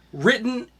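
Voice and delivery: ordinary delivery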